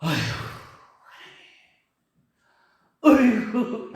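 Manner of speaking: loud anguished sigh to God; dialect sigh of lament. Acted. Prophet sighing to God in Sangju dialect, loud anguished groan. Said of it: "우리 동네 사투리로 하면".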